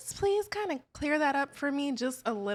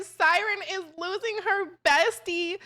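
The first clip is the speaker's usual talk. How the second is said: dramatic announcement; 6 semitones higher, 9 dB louder than usual. Pretend. Host announces dramatic exit.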